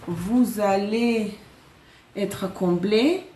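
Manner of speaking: très lentement, mot par mot; détachez chaque syllabe pour rassurer; lent et appuyé. Acted. Prédiction rassurante répétée lentement. (lentement, en appuyant chaque mot)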